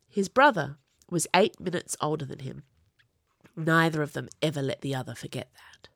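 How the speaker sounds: ordinary delivery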